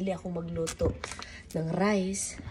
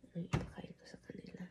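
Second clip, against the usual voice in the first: hungover; 14 dB quieter than usual. Real, not acted. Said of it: "Na-hangover ako guys".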